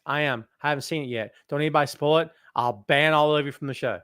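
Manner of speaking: ordinary talk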